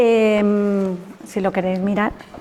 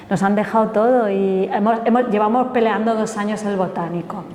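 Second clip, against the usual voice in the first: ordinary talk, nothing marked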